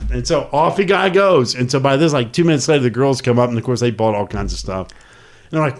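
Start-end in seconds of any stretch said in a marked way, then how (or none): none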